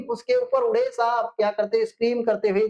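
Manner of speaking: ordinary delivery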